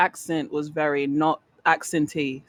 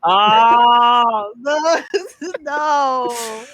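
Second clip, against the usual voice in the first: laughing protest; 7 semitones higher, 4 dB louder, much slower than usual. Real, not acted.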